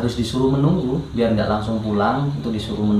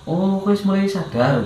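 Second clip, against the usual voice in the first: relieved surprise; 9 semitones higher than usual. Retold or imitated. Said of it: "gitu kan".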